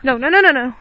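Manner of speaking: loud urgent no; loud protest. Real, not acted. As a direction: shout to stop her. Stopping snow at camera.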